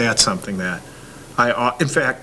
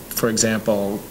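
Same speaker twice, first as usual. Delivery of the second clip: normal talking voice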